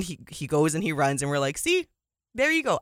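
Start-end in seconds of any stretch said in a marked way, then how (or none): 1.6-2.8 much higher-pitched, at normal volume; retold fan vindication. Fans watching Lamar Jackson finally run, high-pitched mock vindication. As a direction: imitate a fan's high-pitched "told you so".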